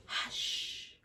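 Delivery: breathy whispered shush; whispered hush. A narrator's reading. Old lady whispering to the room to hush. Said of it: "who was whispering".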